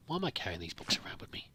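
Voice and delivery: half-whispered question to himself; whispered aside. Real, not acted. Player puzzling over his own inventory, whispered. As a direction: drop to a breathy whisper, puzzled.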